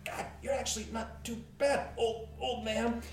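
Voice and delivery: a hint of a cocky young woman. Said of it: "She goes".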